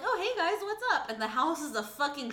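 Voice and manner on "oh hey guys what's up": high, loud, breezy innocent voice; hammed-up airhead acting like nothing happened; mock ditzy Mary. Mary greets the shocked couple, high and falsely breezy. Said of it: "she's like".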